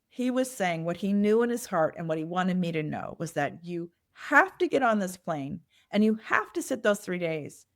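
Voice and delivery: usual speaking voice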